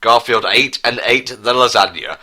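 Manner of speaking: normal talking voice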